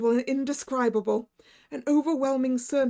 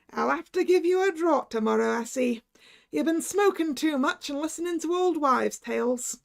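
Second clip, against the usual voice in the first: Irish doctor; 4 semitones higher than usual. Acted. Irish doctor teasing the narrator, jovial Irish voice. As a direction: tease him in an Irish lilt.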